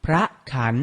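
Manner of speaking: พระขัน said carefully as phra-khan; careful teaching read of พระขัน; pronunciation model. Shown as a demonstration. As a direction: model พระขัน in two separated syllables. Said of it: "อ่านว่า".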